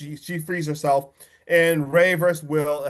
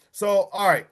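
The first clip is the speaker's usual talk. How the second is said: ordinary talk, nothing marked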